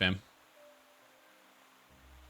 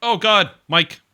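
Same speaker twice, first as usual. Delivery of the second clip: surprised exclamation; 10 semitones higher, 11 dB louder, slower than usual. Real, not acted. Streamer reacts to guest mic problem.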